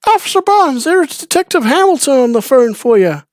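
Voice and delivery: very high, loud calling voice; waitress calling the officer to the phone, high and loud; Carol calling out. Retold or imitated. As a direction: call across the room in a high lady voice. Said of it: "Carol yells at you from across the room".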